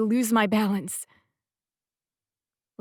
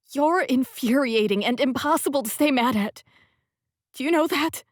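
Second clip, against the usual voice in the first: higher, louder exasperated scolding; too angry; 5 semitones higher, 6 dB louder than usual. A narrator's reading. Heroine scolding Sebastian, exasperated and louder. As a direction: scold him though you can't stay mad. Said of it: "My voice comes out far too angry".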